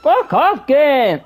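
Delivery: loud angry shouting at the game; swearing tirade. Real, not acted. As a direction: yell the swears in frustration. Raging at the game.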